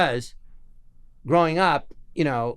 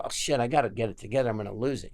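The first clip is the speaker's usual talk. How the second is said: retold choking up; 6 semitones lower, 6 dB quieter, faster than usual. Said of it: "I started getting tears".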